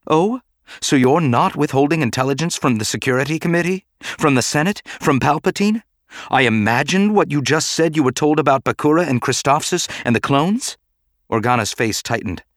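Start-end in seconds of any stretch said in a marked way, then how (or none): 0.1-5.8 high, challenging questions; sarcastic accusation. A narrator's reading. Padme accusing Bail of hypocrisy, sarcastic. 6.3-10.8 higher, pointed challenge; sarcastic accusation. A narrator's reading. Padme catching Bail withholding secrets, sarcastic.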